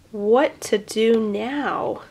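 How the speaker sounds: usual speaking voice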